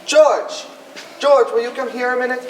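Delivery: calling out. Acted. Father calls the groom over.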